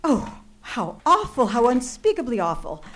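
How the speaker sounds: nearly weeping at what she has heard; horrified. Acted. Woman reacting to an overheard murder plot, tearful horror.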